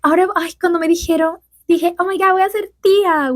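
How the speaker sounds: high-pitched imitation of a thrilled aunt-to-be; excited mimicry, pitched well up; mock excitement. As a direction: gush it high, as if quoting her. Mimicking her thrilled Spanish answer.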